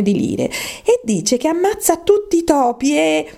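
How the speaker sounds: lightly voiced eager storytelling, rising to high excitement; Alice, enthusiastic. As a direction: chatter on happily, carried away.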